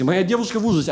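ordinary talk, nothing marked

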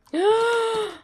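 high sharp gasp of delight. Real, not acted. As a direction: gasp as if the goal just appeared. Reaching the end of the maze.

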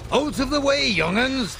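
high playful teasing. A narrator's reading. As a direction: tease the little ones. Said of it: "teased Patrick".

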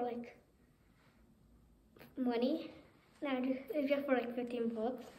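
muffled behind cloth mask; speaking with face covered; through a face mask. Real, not acted. Seen 5: Speaker wears a full-face mask covering the mouth throughout the labelled words.

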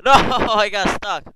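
high, loud laughing cry, slowed; laughing yelp of frustration. Real, not acted. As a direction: laugh in disbelief at getting stuck. Laughs while failing climb.

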